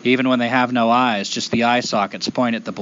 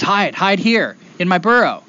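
higher-pitched urgent woodchuck voice; urgent helper; 6 semitones higher, 3 dB louder than usual. Acted. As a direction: urge the boy to hide, pitched up. Woodchuck urgently waves boy over.

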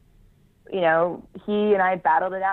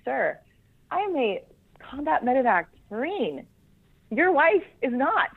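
higher-pitched, emphatic pushback, no shouting; retold indignant retort; 8 semitones higher than usual. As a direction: re-enact standing up to a superior, stretching words. Retelling her retort to officer.

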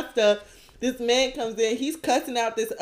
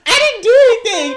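laughing protest; 11 semitones higher, 7 dB louder than usual. Real, not acted. Laughing at absurd accusation.

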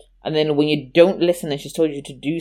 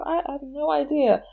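put-on high small voice of a guilty child, a bit softer; small guilty child's voice, higher and softer; timid little me; 12 semitones higher, 5 dB quieter than usual. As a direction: play the caught kid denying it meekly. Caught lying, answering mum.

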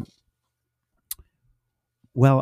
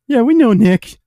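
mocking; 11 semitones higher, 7 dB louder than usual. Rookies mocking the speaker.

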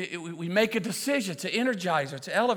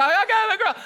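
very high, comic mimicry of an angry woman; mock-hysterical drive-thru complaint; yelling customer; 5 dB louder than usual. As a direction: squeal it like an outraged customer.

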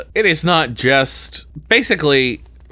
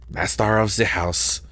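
hammed-up French accent; super French accent; 4 semitones lower, 6 dB quieter than usual. Trying Master of the House super French, thick French accent. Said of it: "like, a super French".

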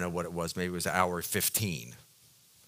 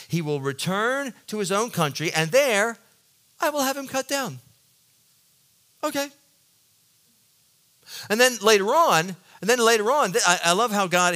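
much higher and louder, incredulous preaching; full-force preaching; 8 semitones higher, 5 dB louder than usual. Acted. Pastor to congregation about God's plan, preaching at full force. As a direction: hammer home how absurd the plan sounds.

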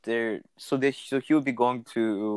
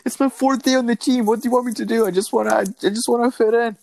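put-on high, louder nervous kid voice; hammed-up anxious teenager, much higher; whiny rookie voice; 12 semitones higher, 5 dB louder, faster than usual. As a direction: play a panicky rookie pleading to fit in. Voicing the rookie Marner.

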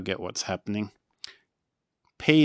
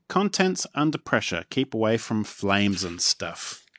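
ordinary delivery

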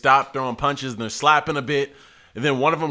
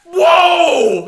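loud, high-pitched astonishment; shocked exclamation; 9 dB louder than usual. Retold or imitated. Bystander watches a kick to the head, shocked. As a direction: reenact seeing a brutal kick.

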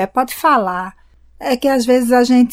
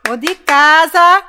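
calling at the door; 3 semitones higher, 6 dB louder, slower than usual. Acted.

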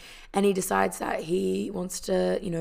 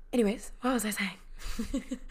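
breathy giggling whisper; laughing whisper; 4 semitones higher, 4 dB quieter than usual. Real, not acted. Laughing after a burp.

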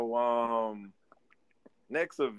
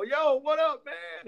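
hopeful greeting. Retold or imitated. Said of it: "And I go".

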